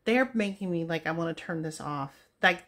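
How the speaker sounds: normal talking voice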